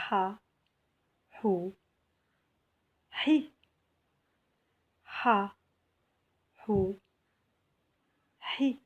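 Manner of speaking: "Ha, hou, hi" each with a brief vowel, spaced apart; short vowels. Shown as a demonstration. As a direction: read "Ha, hou, hi" as separate short syllables. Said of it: "on va voir les voyelles courtes".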